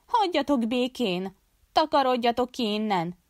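high-pitched narrated outcry; cornered cry, not a full shout. A narrator's reading. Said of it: "kiáltotta".

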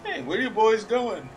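loud high taunt; mocking shout. Real, not acted. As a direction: yell to mock them. Taunting fleeing enemies.

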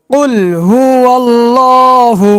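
tajweed recitation voice; Quranic recitation. Shown as a demonstration. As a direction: recite it joined, drawn out and melodic. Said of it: "এবার একসঙ্গে উচ্চারণ করব".

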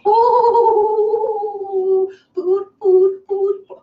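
high, loud drawn-out oh into chant; excited about food. Real, not acted. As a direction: cheer for your meal. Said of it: "Oh, I'm so excited".

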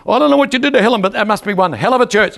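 barber's words high and loud; loud quote. Retold or imitated. Preacher quoting the amazed barber, high and loud. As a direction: quote him with astonishment, high. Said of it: "That's exactly what he said".

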